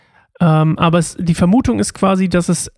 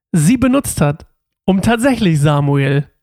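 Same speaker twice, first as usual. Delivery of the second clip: ordinary delivery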